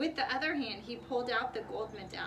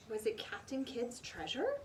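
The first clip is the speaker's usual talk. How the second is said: plain reading voice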